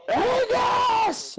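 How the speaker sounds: high-pitched yelled dog's name; screaming. Retold or imitated. Owner calling half-deaf dog, high-pitched scream. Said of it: "I'll be, like, screaming".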